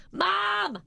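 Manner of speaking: high, drawn-out holler for a parent; high-pitched child-style yell; yelling for Mom. Retold or imitated. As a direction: call out across the house like a needy kid. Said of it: "you're yelling to the other".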